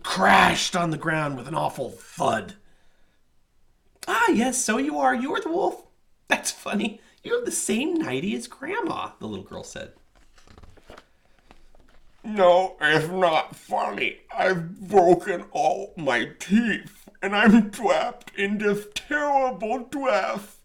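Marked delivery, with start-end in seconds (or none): 4.1-9.1 put-on high, bright teasing girl voice; amused girl. A narrator's reading. Girl laughing at the wolf's nightie, high amused voice. 12.2-20.6 slow, loud whining complaint; miserable wolf. A narrator's reading. Wolf who broke his teeth, slow whining.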